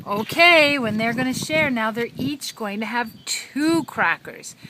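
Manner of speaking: high, loud singsong storytelling; broad singsong kids theatre. A narrator's reading. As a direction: ham it up for a toddler. Reading to a toddler.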